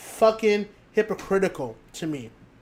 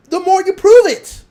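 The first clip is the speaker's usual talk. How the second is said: yelled much louder and higher; furious shout; 11 semitones higher, 12 dB louder than usual. Real, not acted. Ranting at fans online who keep replying, furious shout. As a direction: blast the last line at the fans.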